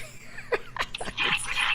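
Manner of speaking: high breathy giggle. Real, not acted. Laughing at cute skunk on map.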